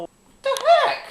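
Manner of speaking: very high surprised squeak; startled squeak. Real, not acted. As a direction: squeak it out in shock. Startled by strange movie.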